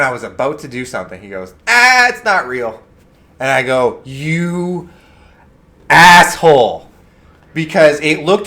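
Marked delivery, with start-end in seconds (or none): 1.7-2.8 high, loud, drawn-out gotcha; mock the prankster springing the joke; gleeful prank reveal. Prankster tells frightened victim the gun is fake, gleeful. 4.0-6.9 drawn-out, high start, then loud punch; slow, exasperated curse with a long gap; indignant retort. Retold or imitated. Victim answers the prankster who fooled him, indignant.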